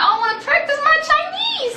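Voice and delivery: very high, loud mock-eager squeal. Teacher answering students keen to swap languages, eager. Voices own eager reply.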